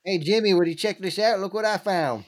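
hammed-up excited finder calling a pal over; old-timer finder. Finder shows Jimmy the dug-up bullet, hammed-up role voice.

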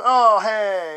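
high, loud, bright surprised greeting; suddenly happy. Retold or imitated. Kicked man spotting the camera, suddenly cheerful and loud. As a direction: switch from anger to delight in a flash. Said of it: "And he's all happy".

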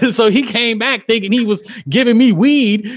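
normal talking voice

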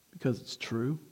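ordinary talk

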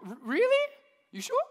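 very high, teasing "really? you sure?"; mock disbelief. Friend doubting the mom's rule, high teasing disbelief. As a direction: needle him with exaggerated doubt.

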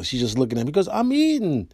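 usual speaking voice

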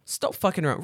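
usual speaking voice

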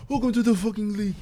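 put-on high, muffled voice with a light African accent; Joel Embiid impression. Friend imitates Joel Embiid's African accent for the group. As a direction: talk as if through a hand on the throat, from the next room. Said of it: "It's that African accent".

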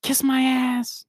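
high, slow drawn-out taunt; taunting agent. Retold or imitated. Retelling the agent mooning them, slow high jeer. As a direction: stretch the jeer out. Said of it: "He's like".

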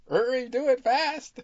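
very high and loud squeak; squeaky dog voice. Pretend.

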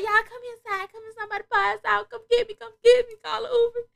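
high, whiny plea; high drunk pleading. Retold or imitated. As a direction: beg your friends to come get you. Drunk pleading texts to friends.

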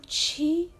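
high, rising question of disbelief; startled, pitch shooting up; astonished. A narrator's reading. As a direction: gasp out the question, wide-eyed.

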